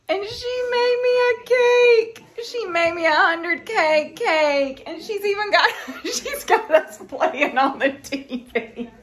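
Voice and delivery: tearful, high and loud, breaking voice; choked up by a friend's kindness; crying while talking. Real, not acted. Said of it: "I'm crying".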